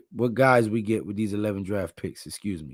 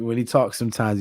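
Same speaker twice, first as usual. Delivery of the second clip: ordinary delivery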